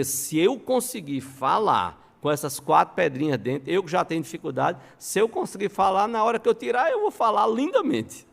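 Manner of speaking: hopeful resolve climbing to a high pitch; Crisóstomo/public. Retold or imitated. Preacher voices a man with pebbles in his mouth, climbing resolve. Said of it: "que ele dizia".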